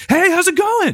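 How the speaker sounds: overexcited barista. Retold or imitated.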